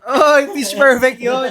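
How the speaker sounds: loud, high, drawn-out exclamation with laughter; laughing excitement. Real, not acted. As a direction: shout the guess out slowly, laughing. Laughing recognition of another clip.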